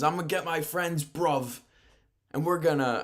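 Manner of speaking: put-on British accent. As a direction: imitate a British wrestler calling out rivals. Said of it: "I'm not doing the British accent".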